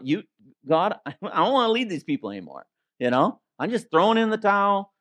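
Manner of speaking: mildly exasperated Moses giving up; frustrated. Acted. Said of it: "He was so frustrated".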